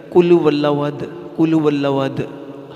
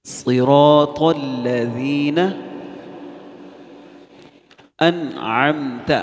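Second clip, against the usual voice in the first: slow chanted Quran recitation; much slower than usual. Shown as a demonstration. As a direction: chant each word slowly. (آہستہ ترتیل سے تلاوت)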